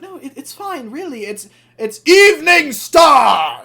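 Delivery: Evening Star brushing off the dent, high, then shouting; high squeaky engine voice. Retold or imitated. Evening Star protesting in a very high voice, ending in a shout. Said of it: "And she's like".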